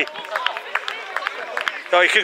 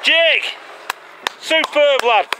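excited shouting; 9 semitones higher, slower than usual. Real, not acted. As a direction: roar it out after a great try. Celebrating a try from sideline.

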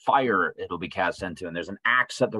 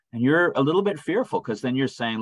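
usual speaking voice

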